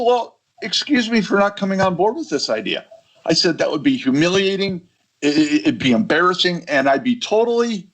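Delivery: high-pitched, indignant retold objection; sarcastic protest. Speaker retelling his objection to the team, sarcastically protesting.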